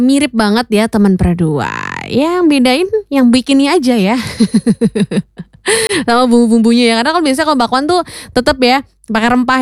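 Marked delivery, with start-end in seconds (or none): 4.2-5.4 short light laugh; brief rippling chuckle. Real, not acted. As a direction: laugh lightly at your own joke. Joke about who makes it.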